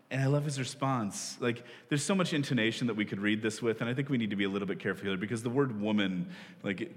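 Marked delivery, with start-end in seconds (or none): none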